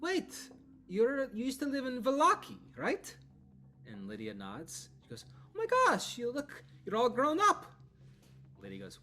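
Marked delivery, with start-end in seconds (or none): 0.0-3.1 much higher, louder, startled; sudden recognition. Acted. Arabelle suddenly recognises Lydia from Vallaki, surprised. 5.5-7.6 much higher and louder, gushing; delighted squeal. Acted. Arabelle gushes at Lydia all grown up, excited.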